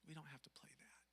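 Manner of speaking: whisper. Real, not acted. Tender reassurance to grieving kid.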